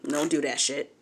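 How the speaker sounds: ordinary talk, nothing marked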